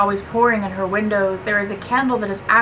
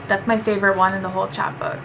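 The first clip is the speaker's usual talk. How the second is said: ordinary delivery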